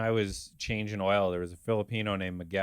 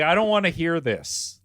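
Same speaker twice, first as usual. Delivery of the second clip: mock loud protest; 6 semitones higher, 6 dB louder than usual. His reply in training.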